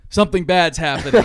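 loud, urgent mock alarm; alarmed warning. Hal crashing in to warn the team, loud mock alarm. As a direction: burst in shouting the warning.

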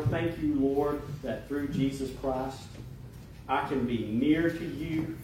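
earnest, measured spoken prayer. Acted. Pastor thanking God and asking forgiveness, earnest prayer. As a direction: pray aloud in short phrases, pausing between them.

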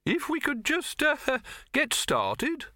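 laughing awkwardly to cut in; nervous interruption. A narrator's reading. Silverfish tries to interrupt.

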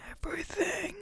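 a gasping inhaled whisper, very high and airy; in-breath whisper. Shown as a demonstration. Showing listeners the mic picks up everything, an inhaled whisper. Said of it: "That was an in-breath whisper".

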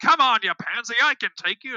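mock taunt.

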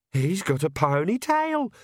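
high, drawn-out squeak; excited squeak. A narrator's reading. Inner voice reacting to a man's ponytail, squeaking. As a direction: squeal it, stretching out the words. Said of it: "squeaked Perdita".

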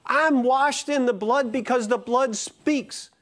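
slow, ringing pulpit proclamation; preaching at full force. Acted. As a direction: build the sermon to its peak with each phrase. Preaching builds to a climax.

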